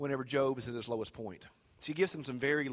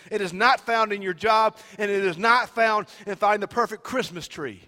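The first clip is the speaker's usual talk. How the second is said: loud, raised preacher's voice; full-force preaching; 8 semitones higher, 14 dB louder than usual. Real, not acted. Preacher declaring hope to the congregation, loud and impassioned. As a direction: proclaim it forcefully to the whole church.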